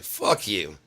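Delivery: angry heckler. Retold or imitated. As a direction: imitate an angry man telling someone off. Imitates angry heckler walking up.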